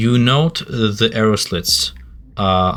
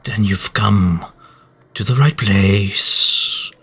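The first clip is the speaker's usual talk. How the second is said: higher, imperious temple guardian; slow, grand demands from the guardian; booming guardian voice; 5 semitones higher, slower than usual. Acted. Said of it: "a booming voice emanate".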